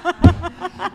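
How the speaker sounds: high, loud burst of laughter; big high-pitched cackle of amusement. Real, not acted. Laughing hard at mispronunciation.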